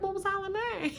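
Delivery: high, hushed mock question; soft mock question. Sierra quizzing Carolyn, hushed and sly. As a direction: ask it softly and slyly. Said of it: "Sierra was like".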